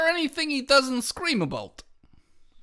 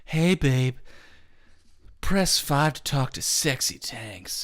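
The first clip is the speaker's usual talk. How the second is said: much lower seductive phone line voice; much lower sultry hotline ad; sexy hotline voice; 9 semitones lower than usual. Retold or imitated. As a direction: purr it like a sex-line ad. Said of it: "It would've been like".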